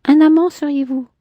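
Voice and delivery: a hint of a proud woman; Clémentine's voice. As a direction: lift into her haughty tone. Man voicing woman in dialogue.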